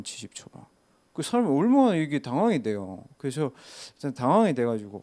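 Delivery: normal talking voice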